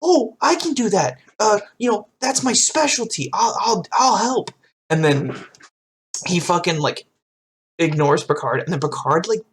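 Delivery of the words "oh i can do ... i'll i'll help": put-on high, eager kid voice; eager Wesley. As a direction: play the overeager teen genius. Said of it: "Wesley's like".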